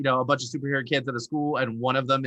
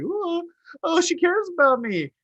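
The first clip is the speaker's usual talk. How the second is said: lovestruck squeak, much higher; mock smitten boy; 14 semitones higher than usual. Mocking a naive schoolboy falling for her, high and smitten. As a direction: swoon like a naive young boy. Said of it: "young boys are like".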